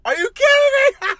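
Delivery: very high-pitched disbelief; incredulous squeal. Real, not acted. Podcaster capping his rant about the video, incredulous squeal. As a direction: squeal it high, on the edge of laughter.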